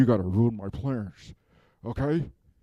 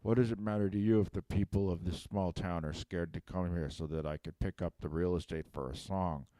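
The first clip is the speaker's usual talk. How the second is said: put-on quoting the kids' question; 6 semitones lower, 6 dB quieter than usual. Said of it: "You say".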